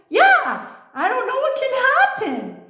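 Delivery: very high, laughing mock echo; mocking reply. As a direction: parrot it back high with a giggle. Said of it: "And I'm like".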